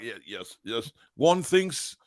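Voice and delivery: slow, mock posh gentleman; lightly voiced posh toff. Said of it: "And then there's Alex".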